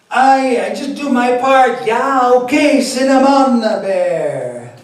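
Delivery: loud, high Jamaican Rasta voice; Rastafari accent. Acted. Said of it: "my Rastafari accent".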